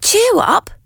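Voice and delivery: high, bright clown voice; cheery little clown. A narrator's reading. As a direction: lift the toys' spirits. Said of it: "said the little clown".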